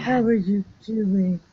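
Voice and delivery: hammed-up theatrical greeting; exaggerated. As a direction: overact it like a soap star. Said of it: "It's so exaggerated".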